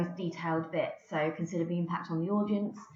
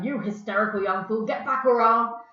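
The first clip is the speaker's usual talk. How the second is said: loud angry rebuke; angry father; 3 semitones higher, 8 dB louder than usual. Acted. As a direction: snap at the son to get back. Father shouting at hysterical son.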